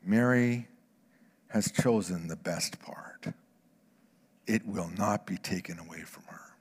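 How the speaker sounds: whispered and slow; reverent whisper. Acted. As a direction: breathe the verse out reverently. Reverent verse reading.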